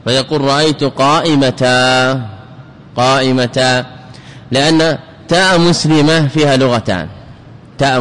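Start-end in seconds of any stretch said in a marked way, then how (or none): 0.4-3.9 قائمتا said slowly, ending qaimata; قائمتا with the ta kept and a long a; pausal alif after ta. Shown as a demonstration. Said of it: "فبعضهم يجريها مجرى المحذوف فيبدل التنوين ألفا".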